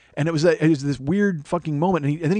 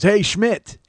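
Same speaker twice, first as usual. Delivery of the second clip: higher-pitched heckle in passing; mock greeting, pitched up; taunting call; 5 semitones higher, 3 dB louder than usual. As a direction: imitate a schoolmate calling out a jeer. Schoolmate calling out mockingly in passing.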